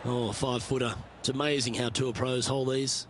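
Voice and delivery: calm, measured broadcast commentary; golf commentator. Acted. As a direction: call the shots like a golf broadcaster. Game commentator calls the shots.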